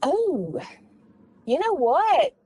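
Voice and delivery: usual speaking voice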